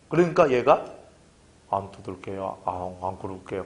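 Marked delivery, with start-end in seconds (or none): 1.7-3.6 put-on small, soft, cowed child's voice; meek promise. A scolded child quietly promising to behave. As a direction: mumble it like a scolded kid. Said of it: "하고 이렇게 했는가 봐요".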